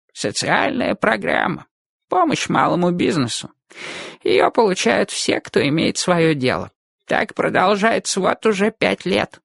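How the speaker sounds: put-on old man voice. Old barber voiced by narrator.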